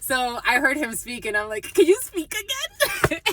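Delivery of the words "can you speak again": high, loud, drawn-out mock squeal; imitating a starstruck, delighted request; excited plea. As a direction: beg giddily, on the edge of laughing. Retells delight at hearing the accent.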